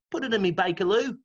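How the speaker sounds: high, mock Cockney punchline; Cockney accent. Proud punchline to friends in a mock Cockney accent. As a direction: land it like a Cockney geezer. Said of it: "Cockney rhyming slang".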